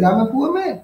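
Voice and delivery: high, thin mock wail; a teacher's imitation of a wailing old man; wailing poor man. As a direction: wail it like a poor father pleading. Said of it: "Jagan wailed, Jagan almost cried".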